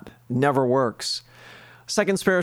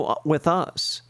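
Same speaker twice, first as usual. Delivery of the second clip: ordinary talk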